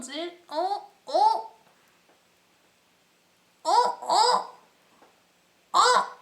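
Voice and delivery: rising excited ohs. Real, not acted. Vlogger shocked by strong highlighter, rising excited ohs. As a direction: squeal in rising delight at the shine.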